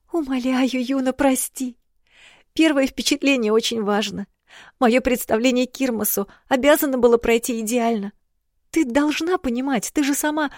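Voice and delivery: put-on high, pleading girl chattering on; rapid tearful princess. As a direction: plead and rush on without stopping. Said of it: "всхлипнула и затараторила без остановки". (тараторящая всхлипывающая княжна)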